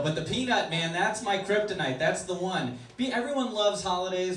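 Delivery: ordinary delivery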